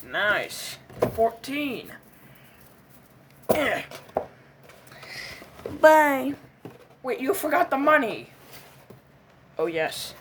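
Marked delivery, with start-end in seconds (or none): none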